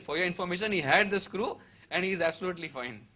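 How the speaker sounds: retold retort. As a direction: put the doubter in his place. Retorting to doubting doctor friend.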